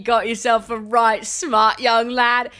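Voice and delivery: loud, slow cockney amusement; cockney singer praising the producer's son. Real, not acted. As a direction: lay on the London cockney, delighted. Delighted cockney praise of the boy.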